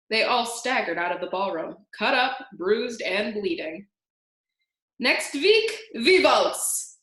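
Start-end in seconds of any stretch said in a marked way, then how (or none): 5.0-7.0 called after them. A narrator's reading. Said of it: "called after them".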